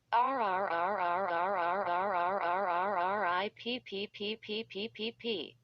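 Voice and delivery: long rising scream then sputter; drawn-out scream. Acted. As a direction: scream as you're torn away.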